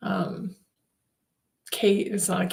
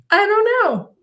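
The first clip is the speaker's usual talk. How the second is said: high, loud mock whine; comic reluctance; 12 semitones higher, 6 dB louder than usual. Asked if she trusts her spiritual team, high loud comic doubt. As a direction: squeal out the doubt, playing it up.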